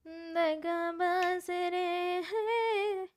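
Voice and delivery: very slow, stretched words; mock dramatic slow drawl; drawn out. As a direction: stretch every syllable lazily.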